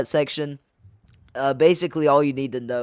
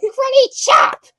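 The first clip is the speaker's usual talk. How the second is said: karate chop yell; 8 dB louder, slower than usual. Pretend. Showing off the action feature.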